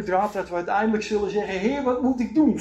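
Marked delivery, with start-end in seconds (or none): 1.6-2.6 pleading cry. Acted. Believer crying out to God.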